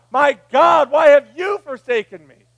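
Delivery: loud, high shouted cry to God; anguished cry. Retold or imitated. Pastor voicing Jesus on the cross, loud anguished shout. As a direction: cry it out like a man forsaken. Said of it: "he cried out to God".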